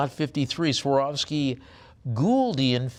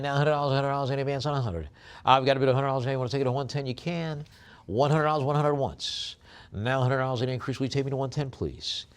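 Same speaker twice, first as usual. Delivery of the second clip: auctioneer bid calling; 4 semitones higher than usual. Acted. Auctioneer calling bids to online bidders, brisk. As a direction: rattle off the bids to close the sale.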